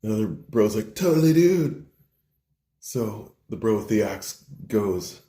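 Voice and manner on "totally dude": pitched-up enthusiastic bro; mock-eager agreement. Bro agrees to meet his hunting buddy, eagerly. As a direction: agree wholeheartedly, high and punchy.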